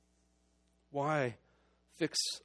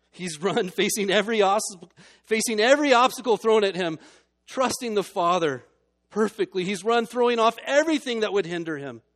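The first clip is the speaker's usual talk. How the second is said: louder and higher, swelling with praise; fervent preaching; 6 semitones higher, 9 dB louder than usual. Acted. Preacher exalting Jesus to the church, fervent full-voiced preaching. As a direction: proclaim the champion's run with fire.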